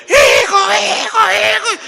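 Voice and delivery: chillido agudo y repetido llamando al nieto; grito de la abuela. Retold or imitated. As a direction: imita a la abuela gritando alarmada. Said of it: "escuché el grito de mi abuela". (alarido imitado de abuela asustada)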